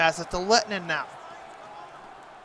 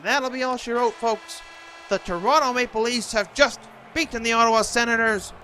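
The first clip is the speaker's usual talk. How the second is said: prize announcer; 4 semitones higher than usual. Acted. Announcing the final result to folks.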